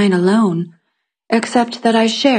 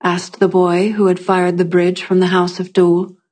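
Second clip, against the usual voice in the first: ordinary delivery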